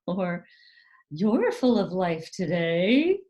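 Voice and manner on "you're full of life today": slow, snide parental remark. Acted. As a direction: imitate a put-down posing as an observation. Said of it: "in this kind of snide".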